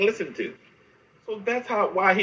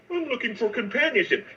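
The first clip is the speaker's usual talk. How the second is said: higher, softer mimicked line; mock-formal quote; 5 semitones higher, 4 dB quieter than usual. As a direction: lightly mimic a man too proper to be real. Mimics what men never say.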